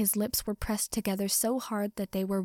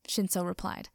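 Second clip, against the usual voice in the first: usual speaking voice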